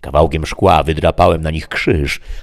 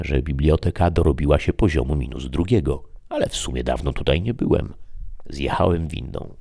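usual speaking voice

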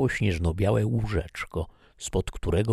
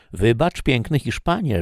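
coaxing maid; 6 semitones higher, 5 dB louder than usual. Acted. Sylwia coaxing the young Spaniard.

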